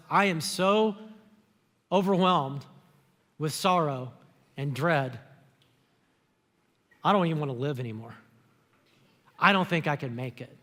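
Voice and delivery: low, heavy, grieving voice; sorrowful. Retold or imitated. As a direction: voice him sinking into sorrow. Jesus voiced in deep sorrow.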